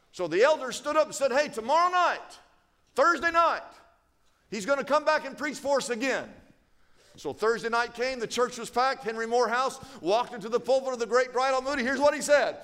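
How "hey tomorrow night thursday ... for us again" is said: high, raised announcing voice; excited announcement. Acted. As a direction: call it out to a whole congregation. Elders announce to the congregation.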